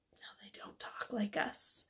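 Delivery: whisper. Real, not acted. Teacher answering own question.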